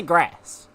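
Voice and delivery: GRASS with the American "a" of "cat"; American pronunciation. Shown as a demonstration. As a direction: mimic the disliked American GRASS, vowel as in "cat". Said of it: "I don't like grass".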